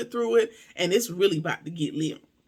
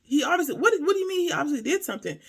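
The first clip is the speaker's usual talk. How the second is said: higher, quick, indignant echo; incredulous challenge; 6 semitones higher, faster than usual. Real, not acted. Host challenges an excuse for police, incredulous. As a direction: throw it back in disbelief.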